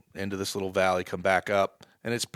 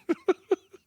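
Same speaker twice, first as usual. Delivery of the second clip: sudden high burst of laughter; loud laugh; 5 dB louder than usual. Real, not acted. Laughs at his own blunder.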